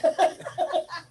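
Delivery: quick high giggle; short amused laugh. Real, not acted. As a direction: giggle mid-sentence. Laughing at the story.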